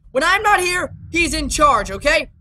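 ordinary talk, nothing marked

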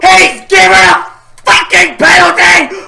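loud, outraged yelling; furious shouting. Real, not acted. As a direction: shout in disbelief at the ref. Furious reaction to penalty given.

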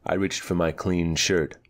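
ordinary delivery

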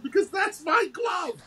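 high, drawn-out retold shout of disbelief; incredulous exclaim. Telling the kid it's his old glove, a high incredulous shout. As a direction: blurt it out like he can't believe it.

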